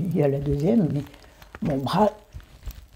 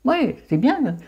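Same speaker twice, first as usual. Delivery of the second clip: high put-on voice; 9 semitones higher, slower than usual. Mock reply in a put-on voice.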